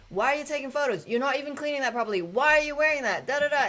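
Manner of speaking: loud, high, fast confrontation; indignant stranger yelling at the suited workers; retold shouting at the cleaners. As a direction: fire the questions at them like an angry passer-by. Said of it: "And this guy was like".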